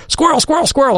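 high, excited French buddy squealing squirrel; excited shout. Retold or imitated. French buddy sees a squirrel, high excited squeal. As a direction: imitate a thrilled French friend spotting a squirrel.

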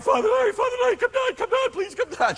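very high mock-tearful begging; high-pitched wailing imitation; imitated crying plea. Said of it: "And he's crying".

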